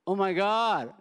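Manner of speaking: stunned to meet Jesus face to face; shocked exclamation. Retold or imitated. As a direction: blurt it out in shock. Meeting Jesus after death, shocked.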